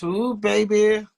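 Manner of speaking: ordinary delivery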